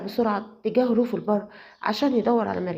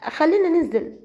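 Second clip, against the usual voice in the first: higher, flustered and stumbling; a nervous girl covering something up, voice jumping up; nervous fiancée dodging the question, high and flustered; 7 semitones higher than usual. Acted. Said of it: "ردت مريم بتوتر".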